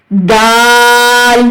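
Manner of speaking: "دال" stretched slowly, recited; drawn-out letter. Shown as a demonstration. Teacher reciting the letter "دال" for students, stretched. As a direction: chant "دال" long and even.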